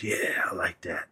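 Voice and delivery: whispered, creepy and close. Retold or imitated. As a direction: breathe it like a creepy murmur in his ear. Damon creepily murmurs during hug.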